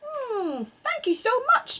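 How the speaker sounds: lightly high mother duck; Missus Duck. A narrator's reading. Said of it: "said Missus Duck".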